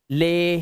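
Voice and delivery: ले with a long held ए; "ay" sound. Shown as a demonstration. Teacher sounding out the answer, stretched ले. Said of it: "तो ये हो गया ले".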